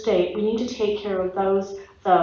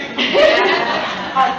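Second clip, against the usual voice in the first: laughing; 4 semitones higher, 8 dB louder than usual. Real, not acted. Nervous laugh at own example.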